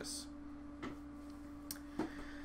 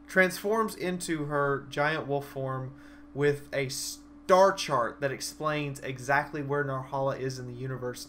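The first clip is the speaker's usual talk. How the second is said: ordinary talk, nothing marked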